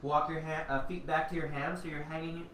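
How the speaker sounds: ordinary talk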